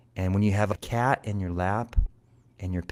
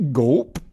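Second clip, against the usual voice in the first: loud, comic gulp; swallowing sound; 4 semitones higher, 8 dB louder than usual. A narrator's reading. Cat swallows the drum, loud spoken gulp. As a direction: pop out a cartoon swallow.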